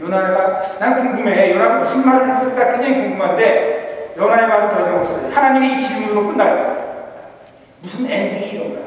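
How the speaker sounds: normal talking voice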